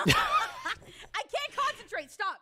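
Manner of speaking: giggling, can't stop laughing. Real, not acted. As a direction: laugh through the protests. Laughing fit during bit.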